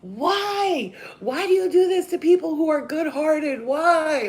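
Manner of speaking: high, loud anguished cry at the sky; retold plea, shouted high to the heavens; crying out to the universe. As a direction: wail it upward, pleading. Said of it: "cried out to the universe".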